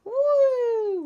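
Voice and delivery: loud, high windy woo; wind's woo. A narrator's reading. The wind whooping as it blows. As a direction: howl it like the wind. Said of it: "went the wind".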